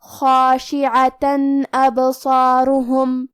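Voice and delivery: خاشعة ابصارہم, tanween said as a clear nun with no hum before the alif; slow chant, nun plain and clear; clear izhar. Shown as a demonstration. Teacher demonstrates izhar to students, slow chant. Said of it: "نون ساکن پڑھیں گے اور بغیر غنہ".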